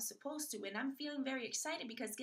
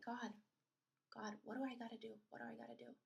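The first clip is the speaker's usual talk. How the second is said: softer, pleading prayer; hushed prayer; 7 dB quieter, faster than usual. Retold or imitated. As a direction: plead quietly to God. Praying to God.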